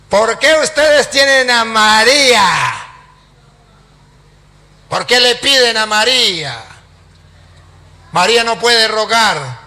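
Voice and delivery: hammed-up preacher's challenge; accusing challenge. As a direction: throw it at the crowd, imitating the pastor. Acts pastor's accusing questions.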